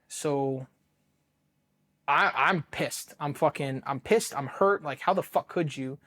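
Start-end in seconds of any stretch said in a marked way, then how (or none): none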